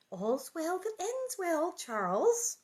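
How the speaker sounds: high, slow, pointed reproof; interrupting wife. A narrator's reading. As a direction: cut in primly to close the subject. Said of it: "Ma interrupted".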